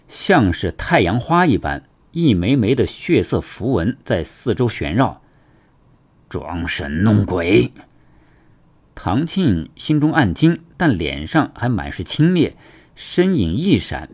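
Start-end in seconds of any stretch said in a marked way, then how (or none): 6.3-7.7 low, slow sneer; scornful dismissal. Acted. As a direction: drag it out with contempt. Villain scorns the opponent's magic.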